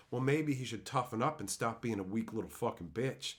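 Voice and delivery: normal talking voice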